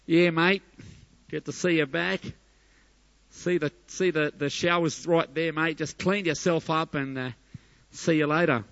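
breezy Aussie dad brushing off his son; Aussie father. Acted. Aussie father greeting returning son, breezy Aussie accent. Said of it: "An Aussie father said".

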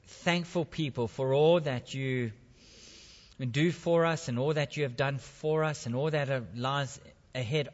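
soft, low, unhurried prayer voice; leading the church in a gentle prayer; hushed prayer. Acted. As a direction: pray quietly on behalf of all. Leads a quiet congregational prayer.

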